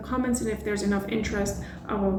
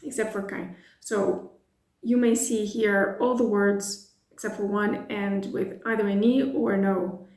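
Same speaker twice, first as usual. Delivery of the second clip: ordinary talk, nothing marked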